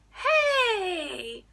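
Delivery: loud, high cartoon holler; over-the-top shouted hello; clownish cartoon character. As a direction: greet them like a human cartoon. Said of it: "he's like a clown, like a cartoon".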